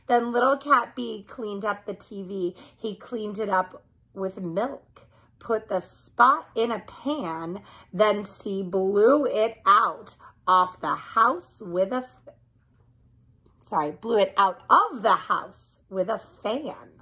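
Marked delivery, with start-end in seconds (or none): none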